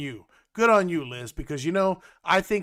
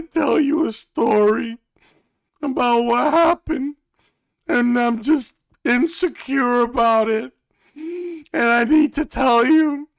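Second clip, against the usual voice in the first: higher, louder whiny impression; overdone needy wife voice; mocking Danielle; 6 semitones higher, 6 dB louder than usual. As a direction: lay on insecurity, louder each line. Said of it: "So, Danielle".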